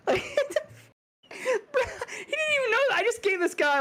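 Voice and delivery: words squeezed out through high laughter; can barely get it out for laughing; real laughter breaking the line. Real, not acted. Laughing hard telling story.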